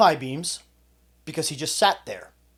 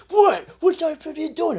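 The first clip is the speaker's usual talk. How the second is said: imitating him, much higher and louder, outraged; mock startled guy; 8 dB louder than usual. Tough guy yanked from his car, mocked as startled and outraged. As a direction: squeal out his startled protest.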